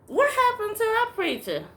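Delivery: retold shock at the new face; surprised question. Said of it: "I'm like".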